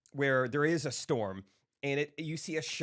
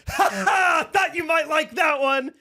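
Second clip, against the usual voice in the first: loud gloat; 14 semitones higher, 9 dB louder, slower than usual. Retold or imitated. Stranger gloats after joke.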